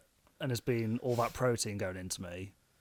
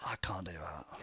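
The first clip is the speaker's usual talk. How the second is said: voiceless mock whisper, much lower; 8 semitones lower, 14 dB quieter than usual. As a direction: echo it in a secret whisper. Echoing the hushed warning.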